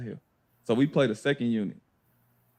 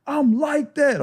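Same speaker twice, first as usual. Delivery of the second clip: high, boastful shout-out to the whole gym; mock brag, pitched way up; imitating trash talk; 12 semitones higher, 3 dB louder than usual. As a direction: act out a cocky player addressing everybody. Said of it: "Jimmy start talking to the gym".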